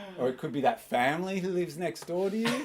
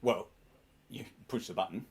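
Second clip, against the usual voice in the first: usual speaking voice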